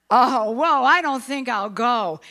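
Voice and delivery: loud startled whoa, backing off; startled refusal. Acted. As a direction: jump back in alarm, then refuse. Jonah recoils in fear.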